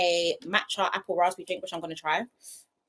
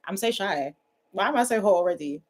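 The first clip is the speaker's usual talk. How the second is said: usual speaking voice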